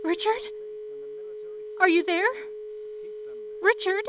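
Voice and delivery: high, anxious calls into the line; desperate calling. Acted. Operator calling for Richard on dead line, desperate. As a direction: plead for an answer.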